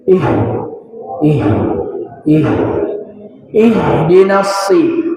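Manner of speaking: ih with air released after the vowel; exhaled h. Shown as a demonstration. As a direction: ihdinas begun as "ihh", breath flowing before "di". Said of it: "seperti itu".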